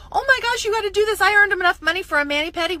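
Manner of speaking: high, loud and fast, bubbling over; gushing excitement. Retold or imitated. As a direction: rave to your friends, can't hold it in. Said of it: "I'm telling my friends".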